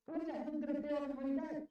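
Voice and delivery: furious rhetorical yell; outraged repeat. Real, not acted. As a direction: demand it again, near shouting. Repeats the question even harder.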